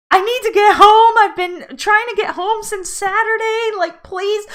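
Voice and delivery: high, slow, tearful begging; retold crying plea. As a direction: plead through tears to the gate agent. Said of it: "I'm crying".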